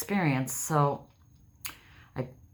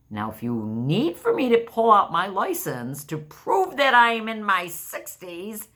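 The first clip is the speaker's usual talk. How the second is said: higher, louder huffy retort; mock indignant; 8 semitones higher, 5 dB louder than usual. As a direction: push back, playfully offended.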